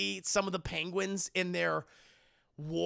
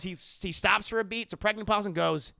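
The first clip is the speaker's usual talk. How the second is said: ordinary delivery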